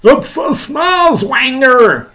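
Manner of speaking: high, drawn-out alarmed shout; crying out. Acted. Police chief naming the monster, high shout. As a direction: stretch the name in a loud high cry. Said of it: "cried the chief of police".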